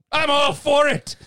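high, loud, drawn-out Scottish burr, badly faked; terrible Scottish accent. Podcast host answering a question from Scotland, bad Scottish accent. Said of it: "that was a terrible Scottish accent".

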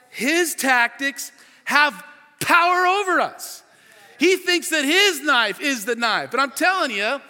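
loud, high, forceful sermon delivery; impassioned preaching. Real, not acted. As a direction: preach it hard, hitting the key words.

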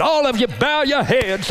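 visiting preacher. Retold or imitated. Visiting pastor ordering the children to bow, mock preacher call. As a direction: raise the pitch and command the room.

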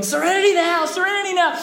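repeated high-pitched mock yells; impatient yelling. Father keeps yelling in the car, high comic shout. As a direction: yell it twice, high and loud. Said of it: "he keeps yelling".

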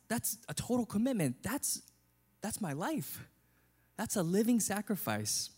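indignant pig. Retold or imitated. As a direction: play the pig, shocked and offended. Pig voiced in a story, outraged.

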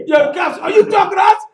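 high, loud challenge to the cops; loud high yell at police; defiant shout. Retold or imitated.

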